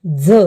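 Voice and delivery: झ said alone, voiced z, punched loud; sound demo. Shown as a demonstration. Teacher stressing the झ sound for students, loud. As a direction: say झ firmly as the model sound. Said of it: "एक्झाम्पल".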